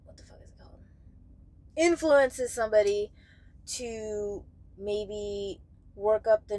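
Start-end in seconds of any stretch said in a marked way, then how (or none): 0.0-0.8 breathy whisper to herself; whispered to self. Real, not acted. Vlogger searching for a word, whispered to herself. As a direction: whisper while hunting the word.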